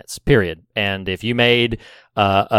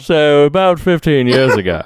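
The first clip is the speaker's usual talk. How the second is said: higher-pitched hammed-up storyteller; playful self-important story start; mock-pompous opening; 4 semitones higher, 3 dB louder than usual. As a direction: launch grandly into a mock boast. Sends up the boastful story opening.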